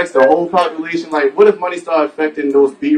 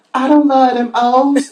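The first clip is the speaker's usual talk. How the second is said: high, slow, loopy stoned [name]; 9 semitones higher, slower than usual. Retold or imitated. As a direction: croon it lazily like [name]. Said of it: "[name] be like".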